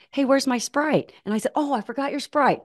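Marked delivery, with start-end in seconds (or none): 0.1-1.1 put-on little girl voice. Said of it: "and Hope goes". 1.6-2.6 surprised. Retold or imitated. Realizing she forgot the Sprite.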